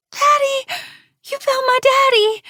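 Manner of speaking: put-on very high, loud child's squeal of joy; happy squeal. A narrator's reading. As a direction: squeal with relief at seeing dad. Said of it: "the little girl squealed".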